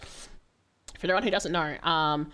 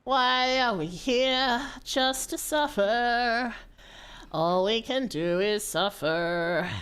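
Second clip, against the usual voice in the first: dramatic lament; 4 semitones higher, much slower than usual. Pretend. Dramatic existential lament, slow.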